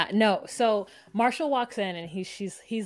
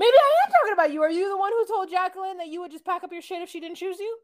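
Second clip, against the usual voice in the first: high, fast, indignant imitation; mock confrontation; 9 semitones higher, 5 dB louder, faster than usual. Boyfriend confronting his rival, high mock-indignant rush. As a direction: fire the accusation in a rush, pitched up.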